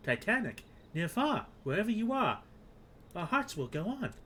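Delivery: high-pitched eager kobold; Gabu. Acted. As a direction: play an excitable little kobold guide. Voices game's kobold Gabu.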